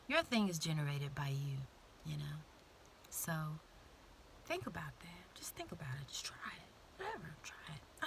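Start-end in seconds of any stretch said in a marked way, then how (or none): 4.4-8.0 hushed, breathy-free whisper nudging them to think; whispered urging. Real, not acted. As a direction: lean in and whisper the challenge. Urging listeners softly.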